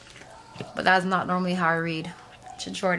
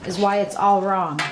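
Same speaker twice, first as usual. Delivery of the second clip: ordinary talk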